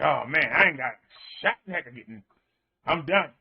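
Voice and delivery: low, mildly defeated groaning voice; mock dejection, pitched well down; acted resignation. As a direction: give up, low and hopeless. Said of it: "You're gonna be standing in that line".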